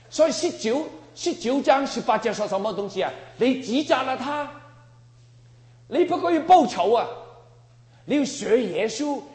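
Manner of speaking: high, loud forbidding of revenge; fiery preaching. Real, not acted. Preacher forbids believers to take revenge, fiery shout. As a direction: thunder it at the congregation.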